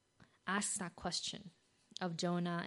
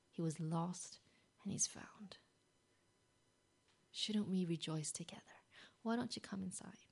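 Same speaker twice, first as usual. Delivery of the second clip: soft, hushed pleading; tender father; 4 dB quieter than usual. Acted. Father softly pleading with his angry son. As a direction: coax him gently, almost whispered.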